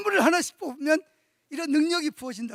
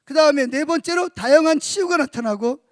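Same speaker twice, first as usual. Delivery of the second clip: loud, rising sermon call to the congregation; fiery pulpit preaching; preaching at full force; 7 dB louder than usual. Real, not acted. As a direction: drive each point home to the church.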